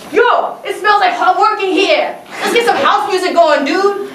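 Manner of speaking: high, slow, pumped-up Vinny impression; loud high hype, stretching each word; hyped dance teacher. Hip hop teacher fires up the class, high and hyped.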